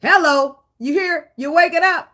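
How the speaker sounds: loud, high call to wake someone up. Acted. To the partner who won't face feelings, a loud high wake-up call.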